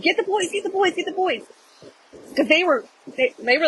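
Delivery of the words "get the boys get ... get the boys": high, urgent plea, volume held back; retold scream. Screaming to Dave for the boys, held-back mock scream. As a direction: imitate a frantic scream without the loudness. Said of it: "So I'm screaming to Dave".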